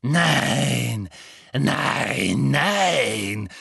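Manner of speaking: savouring, stretched-out refusals; gleefully wicked drawn-out murmur of pleasure; delighted villainess. A narrator's reading. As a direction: relish each no, toying with the captive. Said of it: "Sie schien entzückt, vibrierte vor Erregung".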